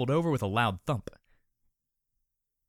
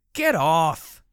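loud angry growl; 7 semitones higher, 12 dB louder than usual. Acted. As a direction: bark it to get her off. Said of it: "The rose-colored colt growled".

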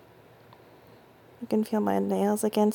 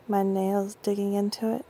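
ordinary talk

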